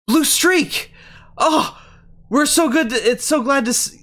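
high, eager cry of relief; excited gasp. Acted. Jailed allies greeting their rescuer, excited and high. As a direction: greet the rescuer with breathless joy. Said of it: "allies who gasp and they say".

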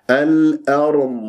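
slow الأرم with heavy r and flowing dad ending; light hamza. Shown as a demonstration. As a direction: keep the hamza of الأرم thin, let the last sound run on. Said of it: "هكذا بهذه الطريقة".

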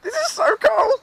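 very high, loud excited squeal; real thrill. Real, not acted. Child about to go down the slide, thrilled shout.

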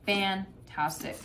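ordinary talk, nothing marked

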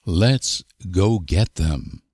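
usual speaking voice

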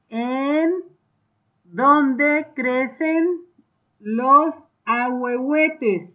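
slow, drawn-out dictation pace. Shown as a demonstration. Teacher dictating slowly to schoolchildren, drawn out. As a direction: spell it out slowly so children can write. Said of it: "Y ustedes van a escribir".

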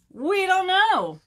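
high, loud, slow chorus; group shout. Acted. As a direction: wail it out slowly as the whole crowd. All the animals answer.

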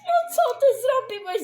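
loud, high-pitched reproach; appalled outburst. Acted. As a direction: confront him in alarm. Confronting Felix about betrayal. (oburzony, głośny wyrzut)

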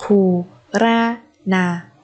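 very slow, separated "Puranada"; syllable by syllable. Shown as a demonstration. Teacher modelling a word for learners, very slowly. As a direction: space out every syllable of "Puranada".

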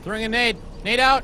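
grenade warning yell. Real, not acted. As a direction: shout the warning to the team. Grenade thrown in firefight.

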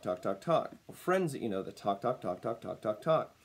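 usual speaking voice

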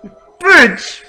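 loud, raging screams at the enemy; furious shout. Real, not acted. Gamer screaming at the enemy Pokemon, furious. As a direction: scream it with real rage.

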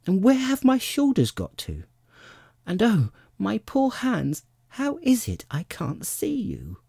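put-on very high, worried child's voice; alarmed. A narrator's reading. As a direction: fret in high-pitched dismay. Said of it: "changed into alarm in another moment".

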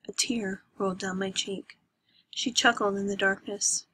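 ordinary delivery

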